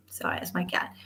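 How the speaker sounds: whisper. Real, not acted. Apologizing to viewers for her cat, whispered. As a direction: hush it like someone might hear.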